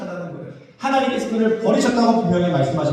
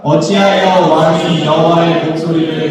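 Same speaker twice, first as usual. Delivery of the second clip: slow, measured scripture recitation; scripture reading; 3 semitones lower, 4 dB louder, slower than usual. A narrator's reading. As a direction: read the verse out slowly, as if leading a congregation. Scripture read aloud in unison. (성경 봉독하듯 느리고 또박또박)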